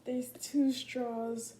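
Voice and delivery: slow, drawn-out amazement; amazed. Retold or imitated. Friend opening the flask, drawn-out amazement. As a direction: stretch the words in awe. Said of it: "She's like".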